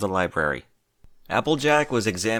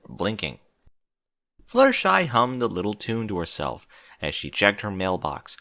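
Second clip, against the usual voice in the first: plain reading voice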